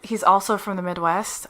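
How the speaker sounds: ordinary delivery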